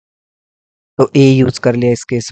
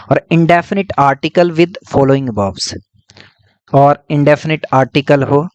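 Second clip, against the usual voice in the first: slow dictation; much slower than usual. Shown as a demonstration. Teacher dictating a heading.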